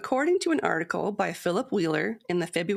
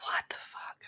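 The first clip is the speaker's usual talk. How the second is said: whisper; 10 dB quieter than usual. Real, not acted. Whispered banter.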